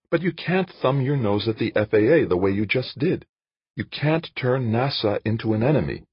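high-pitched female-character voice; Jane, reproving. Acted. Narrator voices Jane scolding Dan.

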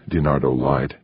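usual speaking voice

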